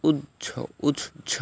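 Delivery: উজ্জ as "uj-jho", in two parts; doubled j. Shown as a demonstration. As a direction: pause between the j sounds in উজ্জ. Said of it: "হয়ের উচ্চারণ জজ".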